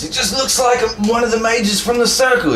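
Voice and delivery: high-pitched, strained character voice; insistent Templar. Acted. Accusing Templar answers his partner.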